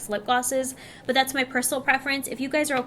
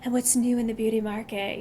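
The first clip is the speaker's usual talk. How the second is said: sexy voice. Pretend. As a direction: say it like a seductive promo. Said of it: "You like how I have to do this sexy voice?".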